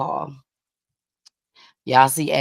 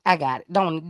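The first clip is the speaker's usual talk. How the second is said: ordinary talk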